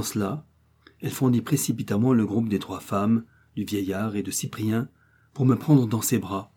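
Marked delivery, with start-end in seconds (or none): none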